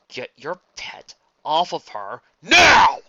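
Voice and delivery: slow, loud commanding yell; furious shout. Acted. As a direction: bellow the order. Prince orders attack stopped.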